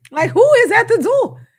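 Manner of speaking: high, loud eager cry; excited. Retold or imitated. Replaying her own thrill at a visitor to viewers, high and loud. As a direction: squeal with excitement at a guest. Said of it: "It seemed like I was a little excited".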